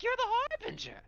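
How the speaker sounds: very high, loud giddy squeal; giddy high-pitched creature cry; giggly hyena man. Acted. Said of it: "starts giggling".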